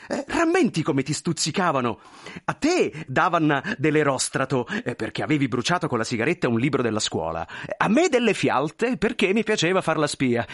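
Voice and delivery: eager, excitable reminiscing; effusive thin man, reminiscing. Acted. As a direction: relish old school nicknames with a friend.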